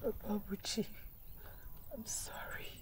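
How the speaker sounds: soft breathy sorry; hushed sorry; whispered apology. Acted. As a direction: whisper it to him. Wife whispers apology to husband.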